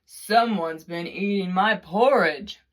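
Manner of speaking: loud, booming papa bear; Papa Bear's big voice. A narrator's reading. Narrator booms Papa Bear's porridge complaint loudly. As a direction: boom it out like a big father bear. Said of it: "in his great, huge voice said".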